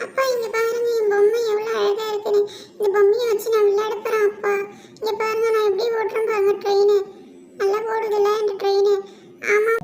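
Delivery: put-on high, loud little-kid voice; excited child. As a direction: show off a new toy to father. Child shows father new toy.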